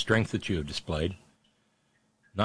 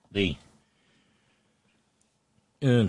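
ordinary delivery

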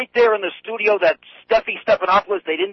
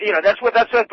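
normal talking voice

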